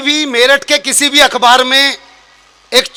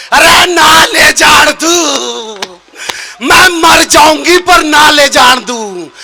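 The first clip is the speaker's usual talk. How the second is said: pleading aunt; 3 semitones higher, much slower than usual. Retold or imitated. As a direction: beg at his feet, dragging every word. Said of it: "पैरों में गिर के बोली".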